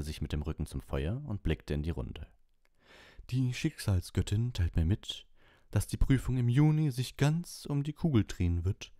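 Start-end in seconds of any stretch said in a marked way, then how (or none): 3.3-8.9 Professor Trelawney. A narrator's reading. Seer teacher reveals exam fate to class, high mystic voice, swelling. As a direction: proclaim it like a prophecy, building louder. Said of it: "rauchige Stimme".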